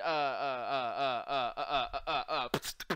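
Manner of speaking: slow stretched-out stammering uhs; Tony Lip stammer. Retold or imitated. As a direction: imitate someone stalling for words. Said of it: "he literally did a Tony Lip".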